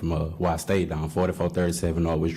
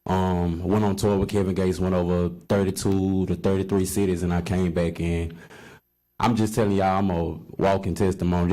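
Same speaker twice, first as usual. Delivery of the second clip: ordinary talk, nothing marked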